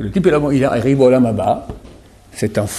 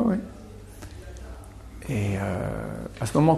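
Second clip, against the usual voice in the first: ordinary delivery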